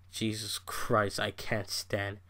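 quiet weary groan of annoyance; exasperated mutter. Real, not acted. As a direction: drop way down and drag it out in disgust. Exasperated aside after mocking commentator.

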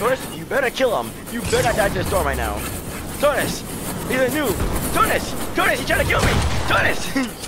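high, loud frantic demands; urgent yelling. Real, not acted. Player demanding his teammate kill the enemy, urgent yelling. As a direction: yell at your teammate to act.

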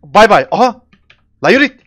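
loud, high gloating yells; gloating shout. Real, not acted. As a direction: shout bye bye as you finish him off. Mocking kill in game.

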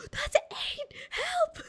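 very high, alarmed yelp; startled squeal. Real, not acted. Streamer lands on the wrong floor, squealing in alarm.

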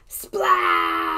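high, loud splash shout; excited shout. Acted. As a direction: shout it as it pops. Balloon pops.

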